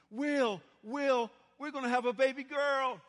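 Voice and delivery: calling loud and high with a laugh, bursting with news; excited calling out. Retold or imitated. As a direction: shout the happy news across the garden, laughing. Wife calls husband in the garden.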